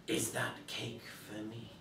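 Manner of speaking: soft, eerie voice from the grave; a dead hand asking sweetly; ghostly voice. Acted.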